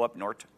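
"north" ending in a hard t, "nort"; Wisconsin "up north". Shown as a demonstration. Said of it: "We talk funny".

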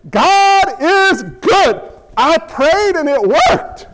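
slow, high, exultant proclamation; acted crowing delight; jubilant celebration. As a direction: celebrate each word in triumph.

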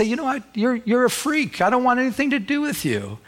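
a hint of a scornful friend turning away; lightly voiced friend sneering at you; scornful former friend. Former friend rejecting the believer, mild sneer. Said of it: "They might say".

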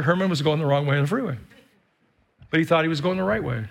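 ordinary talk, nothing marked

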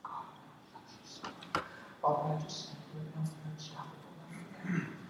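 soft, near-whispered prayer; a hushed prayer for a stranger with migraines; quiet prayer. Shown as a demonstration. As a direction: murmur a gentle healing prayer. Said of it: "You might say".

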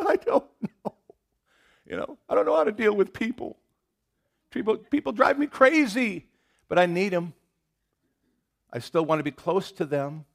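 high, loud put-on bawling voice; mock tearful wail. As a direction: whimper it out like you're about to burst into tears. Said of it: "We just wanna burst into tears".

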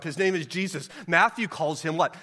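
normal talking voice

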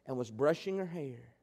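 ordinary delivery

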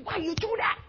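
a mild mock rebuke at his own slip; self-scolding. Young believer scolds himself after swearing, high and soft. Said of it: "And I'm".

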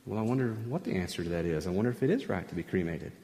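low, soft, quick musing; thinking to oneself. Acted. Preacher voicing listeners wondering about cremation, musing to themselves. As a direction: wonder privately, fast and under the voice. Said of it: "are thinking to yourself".

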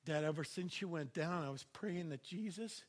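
a soft, lightly voiced little boy; a young son telling his dad, softly and sincerely; son's voice. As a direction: softly voice a sincere five-year-old. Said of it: "my five-year-old".

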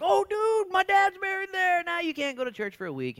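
high, loud, hammed-up outrage over his dad's grave; mock outraged mourner. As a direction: squeal it like a scandalized bystander. Mock complaint about dad's grave.

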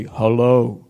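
deep, loud mock-divine greeting; comically deep God voice; booming voice of God. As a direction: boom it out low like God from above. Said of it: "Maybe you've heard God say".

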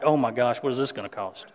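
low, soft private panic; inner worry. Retold or imitated. Husband's hidden thought about the van bill: low, soft worry. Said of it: "in the back of my mind".

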